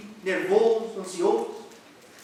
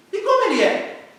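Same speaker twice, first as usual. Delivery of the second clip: high, loud eager question; curious mother; 12 semitones higher, 7 dB louder than usual. Acted. Mother asking her son about God, high and curious. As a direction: lean in, all curiosity.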